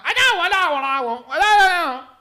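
high, loud mock gibberish yell; imitated shouting. La china yells to the kitchen, high mock shout. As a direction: holler nonsense toward the kitchen. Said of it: "la china le grita".

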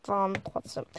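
usual speaking voice

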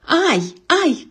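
high sharp cry of pain; pained yelp. Acted. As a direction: yelp as hair is pulled. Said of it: "крикнул Лингвини".

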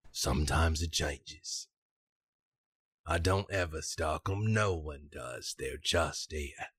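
low, measured rogue's voice with pauses; confiding scoundrel. Acted. Scoundrel swears to Valentine and Desmond about the dolls, confiding. Game master plays the scoundrel.

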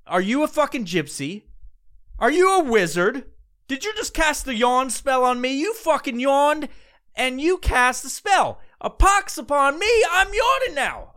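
rising high mock outrage at a yawn wizard; mock accusation. Podcaster accusing a yawner of casting a spell, mock outrage. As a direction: accuse them like a sorcerer, playfully.

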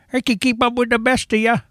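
put-on high, creaky old-timer boasting; old prospector. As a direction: crow like a cranky old geezer. Mocking old man staying up.

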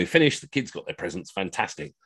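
plain reading voice

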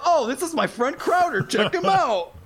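very high, bubbly mock introduction; loud, gushing party-host excitement; Gio. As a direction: imitate an oblivious friend showing off his buddy. Imitates Gio cheerfully introducing his friend.

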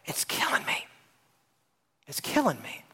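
hushed, pained whisper; a congregant confessing in a pained whisper; anguished whisper. Retold or imitated. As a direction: whisper it like it's crushing you. Pastor voices suffering congregant.

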